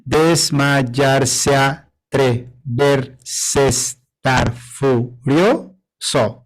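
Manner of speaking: verse broken into bare syllables, drawn out; slow syllable recount; syllables only. Shown as a demonstration. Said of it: "A ver".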